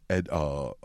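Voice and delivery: ordinary delivery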